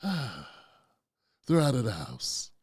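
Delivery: retold relief, drawn out and soft; imitated sigh of relief. Retells wife's relief at kids leaving.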